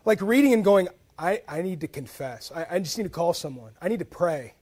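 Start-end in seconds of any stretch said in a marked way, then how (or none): 1.2-4.5 hushed confession. Retold or imitated. Reader convicted by a verse.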